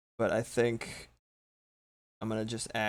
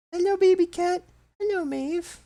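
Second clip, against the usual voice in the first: talking to a cat; 11 dB louder than usual. Real, not acted. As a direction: coo at the kitty in a tiny voice. Greeting her cat Maeve.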